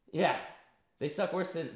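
normal talking voice

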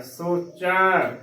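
slow ਸੋਚੈ, ending in open ai. Shown as a demonstration. Teacher spelling out the word, slow ਸੋਚੈ with open ai. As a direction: draw out the open ai vowel closing ਸੋਚੈ. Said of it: "ਚੱਚੇ ਨੂੰ ਦਲਾਵਾਂ ਚੈ".

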